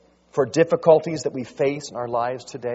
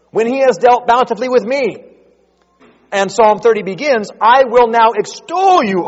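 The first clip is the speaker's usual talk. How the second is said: raised, louder preaching voice, pressing each point; impassioned preaching; 8 semitones higher, 10 dB louder than usual. Acted. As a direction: preach it out with rising fervor.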